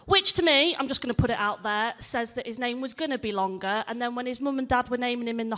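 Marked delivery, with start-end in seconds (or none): none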